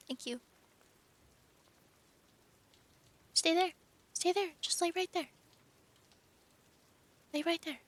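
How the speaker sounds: high, sweet coaxing pet voice; talking to the cat. Real, not acted. Streamer shooing her cat off the words so she can read, high pet voice. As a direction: shoo a cat off gently, sing-song.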